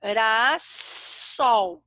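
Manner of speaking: GIRASSOL broken into slow, stretched syllables with pauses; teacher dictating a word in drawn-out syllables. Shown as a demonstration. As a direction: sound out GIRASSOL very slowly, gap after each syllable. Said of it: "Vamos na palavra girassol".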